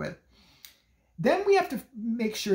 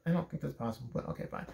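quiet reluctant agreement; 4 semitones lower, 10 dB quieter than usual. Retold or imitated. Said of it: "I was like".

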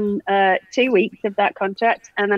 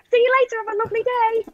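chirpy goodbye; 11 semitones higher, faster than usual. Retold or imitated. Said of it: "She just went".

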